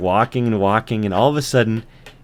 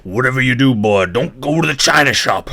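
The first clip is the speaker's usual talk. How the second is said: loud bull voice; gruff bull; 3 semitones higher, 5 dB louder than usual. Acted. Bull giving life advice to the ant, loud gruff voice. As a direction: warn it like a bellowing bull. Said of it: "and the bull says".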